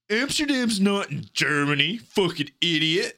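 high-pitched mocking imitation of a friend; scornful mock correction. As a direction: mimic a friend calling out a blunder.

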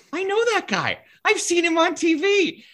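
very high-pitched fanboy squeal, retold; starstruck excitement. Sports nerd spotting TV faces in the hallway, mock-giddy. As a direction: imitate your own giddy recognition, way up high.

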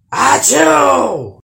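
loud high troll sneeze; huge sneeze. Acted. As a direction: blast out a giant sneeze. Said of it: "went the trolls".

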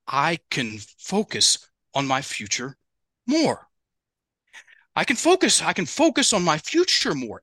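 put-on high, childlike full sentence; sounding like he was four. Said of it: "it sounded like he was four".

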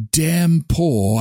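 usual speaking voice

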